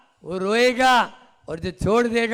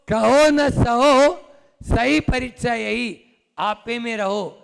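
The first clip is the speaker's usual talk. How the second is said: haiku recitation; 3 semitones higher, 5 dB louder, slower than usual. Shown as a demonstration. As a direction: declaim the verse slowly, then settle. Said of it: "haiku है".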